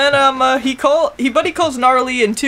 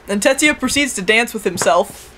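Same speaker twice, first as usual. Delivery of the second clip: usual speaking voice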